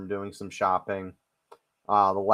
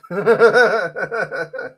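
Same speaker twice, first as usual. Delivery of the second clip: loud laugh; 11 semitones higher, 8 dB louder than usual. Real, not acted. Laughing at his own punchline, real. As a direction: burst of high laughter.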